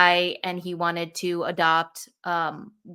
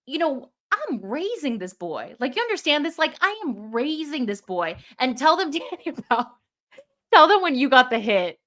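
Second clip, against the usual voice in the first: much higher, animated, half laughing; excited insisting; 5 semitones higher than usual. Real, not acted. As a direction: press the point with playful urgency.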